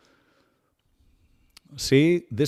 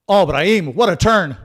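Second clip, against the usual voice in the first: rising thrill, louder and higher; excited; 7 semitones higher, 10 dB louder than usual. Real, not acted. Watching Brahim's turn, getting excited. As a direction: cheer the turn as it happens. Player's great turn on pitch.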